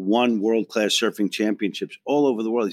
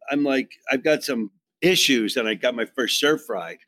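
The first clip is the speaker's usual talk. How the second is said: ordinary talk, nothing marked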